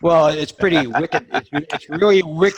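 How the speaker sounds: Boston accent played for laughs; playful Boston accent. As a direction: ham up the Boston slang, amused. Playing up Boston accent after tease.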